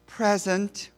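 high, refined "Present"; sophisticated girl. Retold or imitated. Priscilla answers the roll call, high and refined. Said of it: "isn't she sophisticated".

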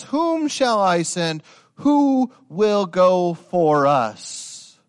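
slow, high, loud calling; the Lord calling for a messenger; voice of God. Acted. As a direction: ask it slowly, weighty and searching. The Lord speaks in vision.

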